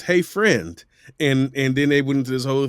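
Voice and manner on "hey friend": ad read translation. Retold or imitated. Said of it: "which is".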